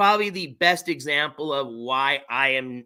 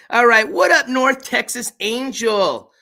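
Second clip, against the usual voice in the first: excited greeting; 8 semitones higher, 7 dB louder, slower than usual. Real, not acted. Loud shout-out greeting to viewer.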